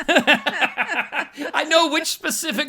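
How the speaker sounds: slow words through laughter; laughing. Real, not acted. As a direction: laugh while you say it. Laughing while speaking.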